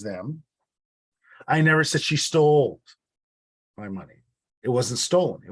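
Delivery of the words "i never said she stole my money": stole pushed louder with a falling tune; stress on stole. Shown as a demonstration. Teacher showing the class stress on stole. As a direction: punch the word stole. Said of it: "I'll prove it to you".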